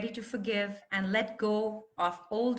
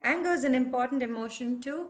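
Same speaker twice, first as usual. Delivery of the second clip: ordinary delivery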